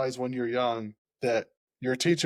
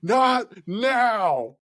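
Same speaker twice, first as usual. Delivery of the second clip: sharp shout, higher and louder; retold yell; 8 semitones higher, 6 dB louder than usual. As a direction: bark it out like a coach losing patience. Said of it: "he just yells at me".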